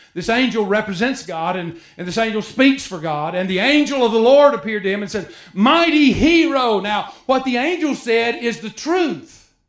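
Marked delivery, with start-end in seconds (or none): 5.5-6.8 angel's proclamation. Acted. Angel addresses Gideon in sermon.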